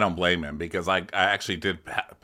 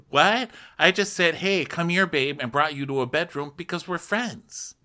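mock indignant; 9 semitones higher than usual. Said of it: "then be like".

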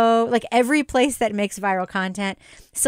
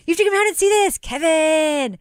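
retold yell; 9 semitones higher than usual. Host calling her partner out to see a moth, mock yelling. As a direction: holler a name from outside, pitched way up. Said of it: "I yelled into the house".

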